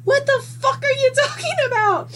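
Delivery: very high, fast imitation of a baffled partner; acted-out exasperation, squeaky and rushed; exasperated outburst. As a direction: throw it back in high-pitched disbelief. Ex's exasperated reply, acted out.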